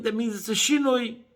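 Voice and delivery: normal talking voice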